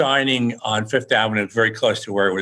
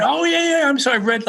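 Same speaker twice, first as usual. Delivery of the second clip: high-pitched retold gush; excited realization. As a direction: imitate a fan suddenly catching on. Stranger realizes who the author is.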